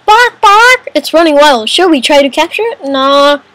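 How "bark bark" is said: high mock clucking squawk; imitated chicken. Player voices a chicken running wild, high squawk.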